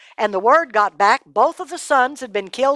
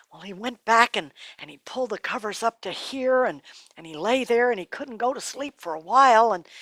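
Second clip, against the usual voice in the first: usual speaking voice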